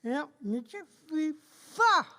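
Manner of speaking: corrected to pas, pencil in mouth; pen in teeth. Real, not acted. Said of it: "avec un crayon dans la bouche".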